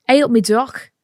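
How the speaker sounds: botched Nottingham accent, sounding Scouse; muddled Nottingham-Scouse greeting; Nottingham slipping into Scouse. Shown as a demonstration. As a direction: aim for Nottingham, land in Liverpool. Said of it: "No, that's Scouse".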